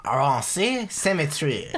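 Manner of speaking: slow, loud, hammed-up French accent like a cartoon French cook; cartoon French chef accent, drawn out and loud; bad French accent. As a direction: ham it up like the French cook in Little Mermaid. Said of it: "you sound like the French cook".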